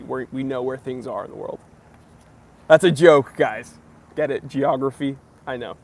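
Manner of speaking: normal talking voice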